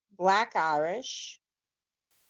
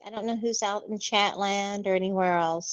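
ordinary delivery

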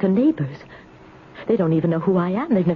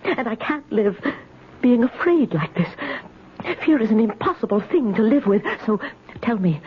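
ordinary talk, nothing marked

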